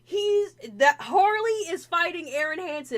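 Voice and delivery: high, loud disbelieving restatement; incredulous repeat. Retold or imitated. Friend repeating the matchup in loud disbelief. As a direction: spell it out in outrage.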